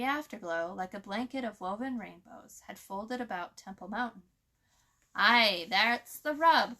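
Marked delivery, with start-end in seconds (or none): 5.1-6.8 hoarsely. A narrator's reading. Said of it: "Crow replied hoarse-, hoarsely".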